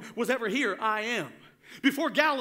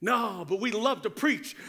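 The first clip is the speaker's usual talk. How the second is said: ordinary talk, nothing marked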